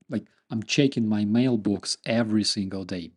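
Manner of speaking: usual speaking voice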